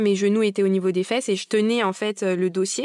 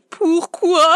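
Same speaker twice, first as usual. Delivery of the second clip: surprised; 9 semitones higher, 7 dB louder than usual. Retold or imitated. Surprised at no epidural offer.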